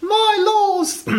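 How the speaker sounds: high, piping mock-British voice; British caricature in falsetto; British accent. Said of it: "should we do it in a British accent?".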